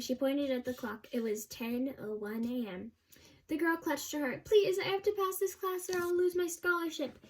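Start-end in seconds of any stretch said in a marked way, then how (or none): 4.4-7.2 high-pitched desperate plea; pleading. A narrator's reading. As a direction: beg for one more chance, voice pitched up. Desperate student begs for her grade.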